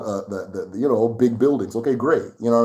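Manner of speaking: ordinary delivery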